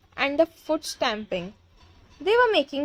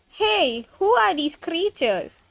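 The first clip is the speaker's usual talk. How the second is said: mildly raised, higher-ending yell; 5 semitones higher, slower than usual. A narrator's reading. Teachers confront the mice, mildly yelling. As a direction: demand it with light alarm. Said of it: "demented teachers is yelling".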